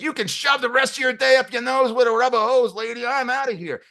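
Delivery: high, strained mock tirade; losing his chips. [name] tells off the receptionist lady, losing his chips. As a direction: rant in a comically high outraged voice. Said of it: "[name]'s losing his chips".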